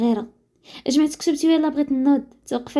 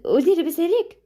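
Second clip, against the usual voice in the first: lightly voiced joyful greeting, higher pitched; happy; 6 semitones higher than usual. A narrator's reading. Father greeting his son Anis, happy. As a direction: lift the question with pleased affection. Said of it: "شاف فيه بفرحة وقال".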